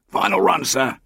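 coxswain calling through a slit, muffled. A narrator's reading. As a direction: call it out muffled, from behind metal. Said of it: "A muffled voice said".

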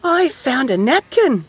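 very high, slow mouse squeak; little mouse. A narrator's reading. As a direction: pipe up with a find. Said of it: "said Mouse".

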